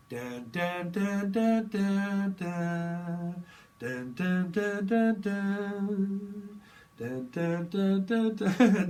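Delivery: sung dun-dun stabs climbing high and loud; dramatic soap sting. Pretend. Mocking a Dynasty scene with a dramatic dun-dun sting. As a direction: hum a cheesy soap opera cliffhanger. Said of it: "a goddamn scene of Dynasty".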